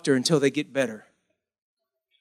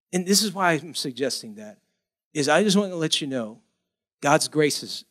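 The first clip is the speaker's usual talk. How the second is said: ordinary talk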